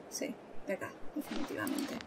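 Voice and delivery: barely a breath, hushed so nothing hears; whispering. Real, not acted. Player hiding from a monster, whispering.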